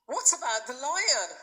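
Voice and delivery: put-on high, urgent boy's plea; frightened question, pitched high; anxious plea. A narrator's reading. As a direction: beg to know about the lion. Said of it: "he cried".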